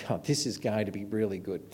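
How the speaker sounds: soft, grumbling inner thought; understated sarcasm, as if thinking to himself; sarcastic grumble. Retold or imitated. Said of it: "I had not had a good evening, and I was thinking".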